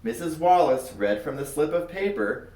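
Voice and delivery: plain reading voice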